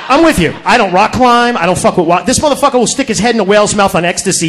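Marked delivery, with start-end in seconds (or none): none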